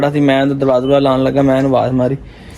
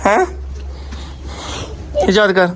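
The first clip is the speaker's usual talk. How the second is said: normal talking voice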